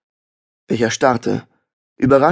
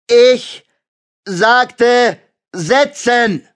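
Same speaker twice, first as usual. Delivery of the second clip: much louder, much higher bellow; roaring shout; 12 semitones higher, 5 dB louder, much slower than usual. A narrator's reading. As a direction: bellow the order at him. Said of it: "brüllte er".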